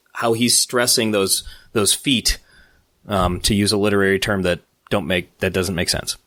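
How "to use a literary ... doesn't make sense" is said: normal talking voice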